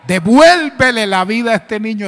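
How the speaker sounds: loud, emphatic plea to God; commanding prayer. Retold or imitated. Leader praying over lifeless child, loud plea. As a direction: cry out the petition forcefully.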